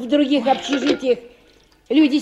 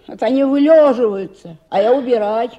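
louder, indignant grumble; loud grumbling; 2 semitones higher, 7 dB louder than usual. Acted. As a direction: complain loudly about the sleepyheads. Cleaner grumbles about lazy tenants. (громко и ворчливо жалуясь на жильцов)

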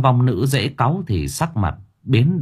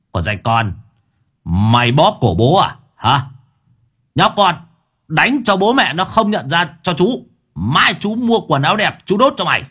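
loud, higher braggart yelling; loud cursing; 5 semitones higher than usual. Acted. Drunk man cursing the ghost with his master behind him, loud. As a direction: gloat and curse now you have backup. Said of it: "tiếp tục ngoạc mồm ra mà chửi".

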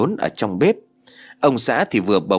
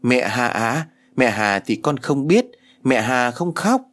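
put-on small daughter sobbing to her father on the phone, a slow child voice; little girl whimpering; 2 semitones higher than usual. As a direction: stretch the words out like a sulky toddler.

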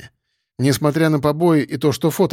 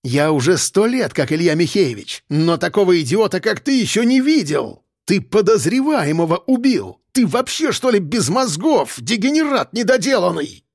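rising to much higher rant; furious berating; 5 semitones higher than usual. A narrator's reading. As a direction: tear into the idiot. Boss berating subordinate.

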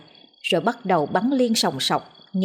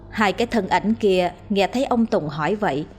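ordinary delivery

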